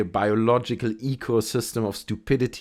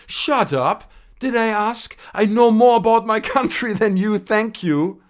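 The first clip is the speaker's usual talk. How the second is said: higher, louder indignant snap; mock angry retort; 10 semitones higher, 6 dB louder than usual. Vlogger voices an offended commenter snapping back, higher and louder. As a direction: snap back up high like an offended know-it-all.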